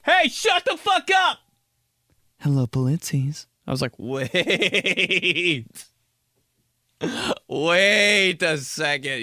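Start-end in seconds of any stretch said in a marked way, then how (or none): 0.0-1.4 high, slow scolding yell; mom's yell. Retold or imitated. Mom before answering the phone, high yell. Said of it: "flip the switch totally". 2.4-3.5 composed, polite phone greeting; mom's phone voice. Retold or imitated. Mom answering the phone, switched to polite phone voice. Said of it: "flip the switch totally". 4.0-9.2 high, drawn-out wait; baffled disbelief. Acted. Child hearing mom's phone voice, baffled disbelief.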